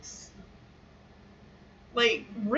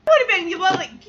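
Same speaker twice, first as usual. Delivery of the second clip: usual speaking voice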